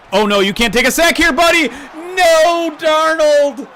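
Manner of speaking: very high, loud yell of disbelief; anguished shout. Real, not acted. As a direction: yell in horror at the quarterback, building to a drawn-out scream. Yells as quarterback gets sacked.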